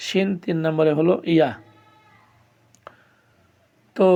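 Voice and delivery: ordinary talk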